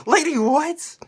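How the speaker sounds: high, loud baffled exclaim; retold disbelief. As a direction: squawk it out in disbelief. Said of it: "I'm like".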